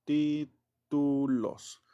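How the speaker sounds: very slow, drawn-out "Títulos" spoken while writing; slow dictation. Shown as a demonstration. Teacher writing on the board for the class, very slow.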